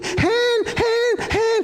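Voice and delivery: put-on very high, piercing little-kid cries; imitated child's screaming. Father imitating his child in the dark tunnel, high screaming. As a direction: shriek each word separately, imitating a scared child. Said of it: "dia teriak lagi".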